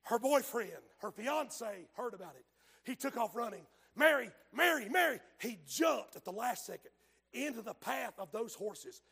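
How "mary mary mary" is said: calling out. Retold or imitated. Boyfriend calling to runaway buggy.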